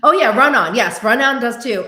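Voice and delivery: normal talking voice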